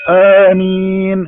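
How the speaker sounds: a loud drawn-out mock amen. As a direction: sing out the amen like a sneer at the prayer. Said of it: "stop mocking".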